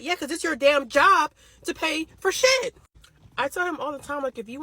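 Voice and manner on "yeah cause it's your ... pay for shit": loud, slow, emphatic comeback; heated retort. Real, not acted. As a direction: spit it back slow and loud.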